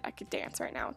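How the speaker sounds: breathy whisper aside. Real, not acted. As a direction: whisper it to yourself.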